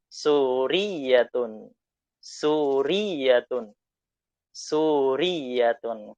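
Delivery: "Suriyatun" drawn out very slowly, falling; slow model word. Shown as a demonstration. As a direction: drill "Suriyatun" for repeating. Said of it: "Karena gambarnya perempuan".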